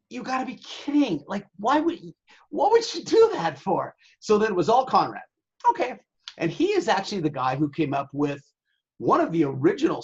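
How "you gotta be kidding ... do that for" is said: incredulous. Retold or imitated. Retold outrage at Conrad firing Tom.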